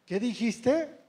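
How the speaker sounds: pregunta de padre, más alta y fuerte; padre retador. Acted. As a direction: retar al hijo subiendo la voz al final. Parent challenging a muttering child. (padre desafiante)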